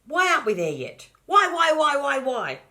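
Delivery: high, loud whining question; whiny shout. Acted. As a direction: whine it like a bored child in the car. Child whining.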